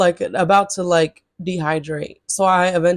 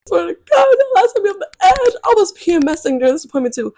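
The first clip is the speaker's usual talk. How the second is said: high whiny venting; 11 semitones higher, 5 dB louder, faster than usual. Real, not acted. Venting about the appointment.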